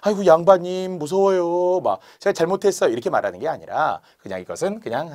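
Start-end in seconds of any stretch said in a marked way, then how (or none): 0.0-2.9 high, hammed-up pleading; mock fearful apology. Servant pretends genuine apology to the nobleman, mock frightened. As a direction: beg forgiveness with exaggerated fright. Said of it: "진짜로 막 잘못해서 변명하는 게".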